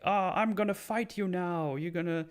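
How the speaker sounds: high, hammed-up taunt; cartoonish high mock villain voice; mock zombie challenge. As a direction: squeak out a silly threat. Acting zombie challenging Power.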